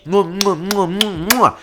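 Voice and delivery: rapid high-pitched "mwah" kisses; smothering kiss noises. Acted. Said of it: "smothering Deku with kisses as all you can hear was".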